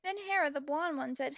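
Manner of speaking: ordinary delivery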